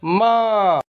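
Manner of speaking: माँ said high and clear for repeating; clear demo. Shown as a demonstration. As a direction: sing out माँ, vowel through the nose. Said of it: "इन शब्दों का उच्चारण करें".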